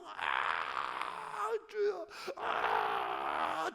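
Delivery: low, drawn-out groan of pain; anguished groaning cry. Retold or imitated. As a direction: cry out to God like a woman in labour. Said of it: "해산하는 여인과 같이 부르짖나이다".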